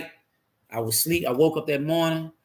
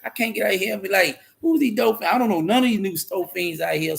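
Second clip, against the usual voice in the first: raised, anxious retelling of his own worry; half-acted fretting, pitched up high; retold nervous worry; 7 semitones higher than usual. Recalling his fear of the new dope fiends, mildly nervous. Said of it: "I'm scared, like".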